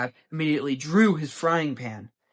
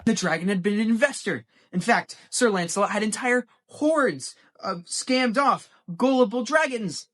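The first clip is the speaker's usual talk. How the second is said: fast, high, louder with building glee; excited rush; 8 semitones higher, 6 dB louder than usual. A narrator's reading. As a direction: pile on the twist eagerly. Excited plot escalation.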